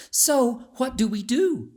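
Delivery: put-on curious young girl. A narrator's reading. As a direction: ask your bold friend what now. Young Beatrice asks friend.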